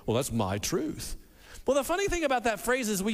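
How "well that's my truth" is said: mock smug. Mocking the phrase.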